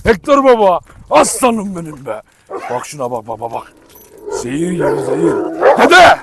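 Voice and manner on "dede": sharp high shout of the name; yell at dog. Real, not acted. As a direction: call the dog off loudly. Yelling at dog Dede. (köpeğe bağırarak)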